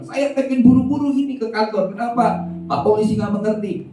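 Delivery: high, exasperated outburst; angry complaint. Acted. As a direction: vent at the police officer in a hurry. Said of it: "dia udah marah-marah".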